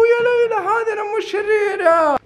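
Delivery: shrieking high in mock fright; mock scream.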